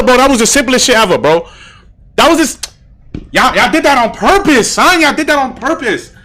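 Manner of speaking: loud, high-pitched disbelief at the chat; real outburst, loud and high; excited shouting. Real, not acted. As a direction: yell it at chat like you got played. Game solved, outraged at chat.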